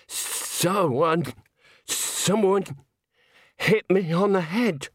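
high, slow, halting stupor; dazed tenor. Acted. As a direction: grope for the words as if concussed. Dazed tenor after being hit.

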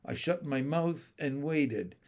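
ordinary delivery

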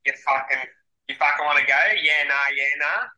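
a rowdy, broad Aussie bloke challenging someone; a laughing South Adelaide tough guy; broad Australian accent. Shown as a demonstration. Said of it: "Australian accents are very hard".

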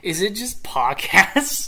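high, loud, slow squeal; squeaky disbelief. Acted. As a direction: squeal it in mock shock. Mock-shocked at missing letter.

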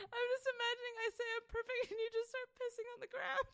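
high, fast, crying with laughter; laughing tearfully. Real, not acted. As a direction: squeak words through a laughing fit.

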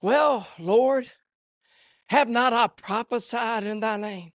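soft, low plea with long pauses; hushed scripture quotation. Acted. Preacher quotes those pleading with the Lord, hushed. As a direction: quote the verse quietly and deliberately.

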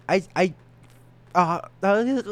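stuck on I and uh; stammering, high and loud; stuttering. Real, not acted. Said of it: "I've become a victim to the stutter".

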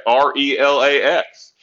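slow, each letter of R E L A X said separately; spelled out letter by letter. Retold or imitated. Mocking how Aaron would say it, spelling R E L A X slowly. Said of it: "He can spell that".

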